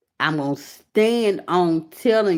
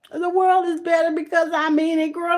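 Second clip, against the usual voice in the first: moved exclamation; 11 semitones higher than usual. Real, not acted. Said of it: "don't make me cry".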